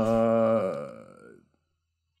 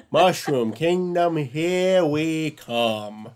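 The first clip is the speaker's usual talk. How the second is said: very slow, drawn-out, higher mock hero line; Chris Pratt as Mario; 5 semitones higher, 3 dB louder, much slower than usual. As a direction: stretch it out like a half-hearted movie Mario. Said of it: "that's about what Chris Pratt did".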